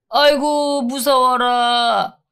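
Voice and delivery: sarcastic high drawled "so scary"; mock fear. Inmate mocking the guard's warning with fake fright. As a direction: pretend to be scared, sneering and slow.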